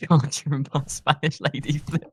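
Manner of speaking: amused and giggly telling; laughing retelling. Real, not acted. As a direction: crack up partway through the sentence.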